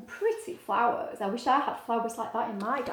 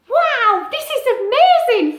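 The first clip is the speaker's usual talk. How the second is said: amazed owlet; 12 semitones higher, 13 dB louder than usual. Acted. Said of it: "thought Ola".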